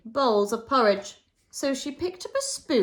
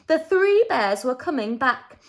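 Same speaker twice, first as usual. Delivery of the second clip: ordinary delivery, nothing marked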